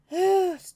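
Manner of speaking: loud, high exasperated sigh. Real, not acted. Reader tangled in sentences, loud exasperated sigh. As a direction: let out a big frustrated breath.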